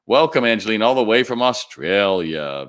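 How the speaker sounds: enthusiastic welcome. Real, not acted. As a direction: call out a big hello to a viewer. Greets a live viewer from afar.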